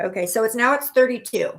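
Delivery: normal talking voice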